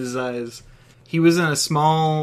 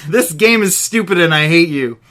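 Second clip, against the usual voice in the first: angry shout; 3 semitones higher, 7 dB louder than usual. Acted. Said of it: "Balak shouted".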